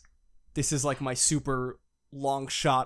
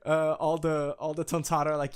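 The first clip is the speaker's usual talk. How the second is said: ordinary talk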